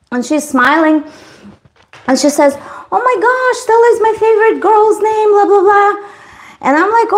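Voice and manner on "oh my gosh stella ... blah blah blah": squealing high, delighted gush; gushing girl. Retold or imitated. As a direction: gush over the name like a fan. Girl gushing about the name.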